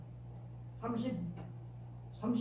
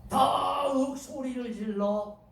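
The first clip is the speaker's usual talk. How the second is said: drawn-out, raised delivery; very slow, high-pitched preaching emphasis; dramatic emphasis; 4 semitones higher, 3 dB louder, much slower than usual. A narrator's reading. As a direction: stretch it out with mounting force. Preacher dramatizes the louder cry.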